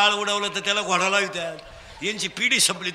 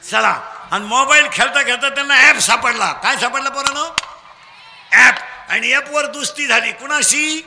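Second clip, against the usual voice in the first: loud, projected rallying of a crowd; call-and-response preaching; 5 semitones higher, 7 dB louder than usual. Real, not acted. Preacher drilling children for loud answers, call-and-response preaching. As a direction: throw each phrase out for the crowd to finish.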